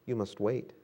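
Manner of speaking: soft, gentle "You must wait."; quiet reply. Acted. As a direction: say it softly, like a patient master. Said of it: "my master replied".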